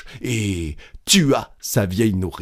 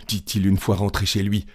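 usual speaking voice

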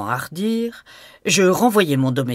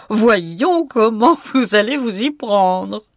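higher, teasing challenge; 5 semitones higher, 3 dB louder, slower than usual. A narrator's reading. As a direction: coax him mockingly. Gabrielle teases narrator. (défi taquin, voix plus aiguë)

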